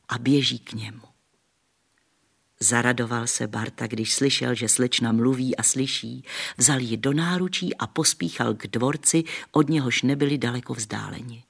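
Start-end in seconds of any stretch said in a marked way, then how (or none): none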